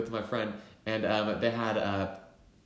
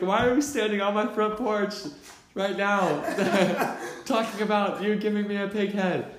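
much higher and louder, broken by laughs; laughing disbelief; 10 semitones higher, 4 dB louder than usual. Retold or imitated. Narrator questioning friends on the front porch, laughing disbelief. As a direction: laugh through the whole question.

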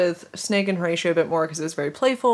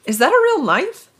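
naive high voice; 12 semitones higher, 6 dB louder than usual. Acted. Reading Horatio's line aloud.

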